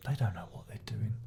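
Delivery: conspiratorial whisper; whispered aside. Acted. Reviewer whispering a snide aside about German bands, hushed. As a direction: hush it like a secret jab.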